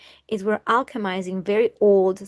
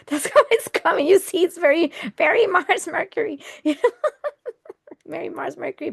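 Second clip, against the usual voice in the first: laughing; 7 semitones higher, 3 dB louder, faster than usual. Real, not acted. Laughing at own swearing.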